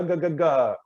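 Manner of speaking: "ga, ga, ga" from the back of the mouth; consonant drill. Shown as a demonstration. Coach drilling back consonants, crisp. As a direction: punch each "ga". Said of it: "into the mouth even further with ga".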